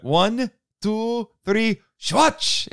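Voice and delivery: high, called-out photo count; imitated countdown. Actor counting fans into a photo pose, imitated countdown.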